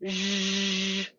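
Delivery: sustained buzzing zh; steady voiced hush. Shown as a demonstration. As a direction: hold a buzzy zh on one pitch. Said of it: "it's the voice version of sh".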